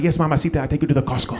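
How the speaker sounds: put-on answering in mom's Bolivian accent; mimicked Bolivian accent. Son replying to mom, copying her Bolivian accent. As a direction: echo a Bolivian accent right back at her. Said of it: "exact same accent".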